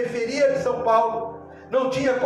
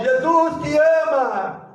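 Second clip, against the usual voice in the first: reenacted distant shout; 3 semitones higher, 3 dB louder, much slower than usual. Man about to jump hears a voice, drawn-out shout. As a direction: stretch it out like a cry from the street. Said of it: "ele ouviu a voz".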